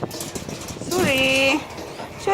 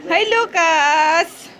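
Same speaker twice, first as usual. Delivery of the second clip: much higher, slow cooing voice; pet baby talk; 10 semitones higher, slower than usual. Acted. Owner greeting Lucas the pet in high baby talk. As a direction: coo up high at the little dog.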